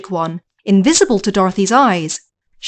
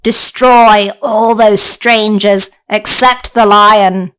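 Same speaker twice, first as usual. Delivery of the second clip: slow, menacing witch order; a witch barking orders, slow; slow wicked witch commanding her flying monkeys; 4 dB louder, slower than usual. Acted.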